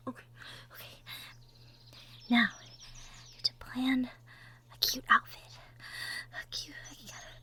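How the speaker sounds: hushed whisper to herself; whispered planning. Acted. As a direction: secret excited whisper. Muttering plans to herself.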